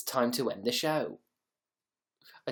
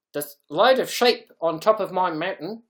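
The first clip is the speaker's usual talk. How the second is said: put-on loud, higher Kiwi accent; hammed-up New Zealand twang; attempted New Zealand accent; 6 semitones higher, 8 dB louder than usual. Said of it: "Try a New Zealand accent".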